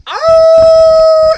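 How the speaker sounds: long high howling "Ow" up at the sky; calling to the wolves in the canyon, a loud wolf howl. Retold or imitated. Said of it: "and go".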